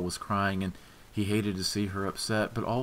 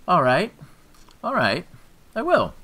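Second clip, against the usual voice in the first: mocking; 11 semitones higher, 10 dB louder than usual. A narrator's reading.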